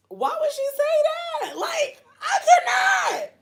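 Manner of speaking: very high squeal through laughter; overcome, squealing laugh-talk; helpless laughter. Real, not acted. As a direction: squeak the words out while cracking up.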